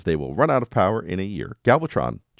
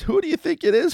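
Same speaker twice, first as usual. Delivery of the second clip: pitched way up, playful tease to the audience; teasing buildup; faster than usual. Real, not acted. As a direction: build suspense like a game-show host. Teasing quiz to listeners.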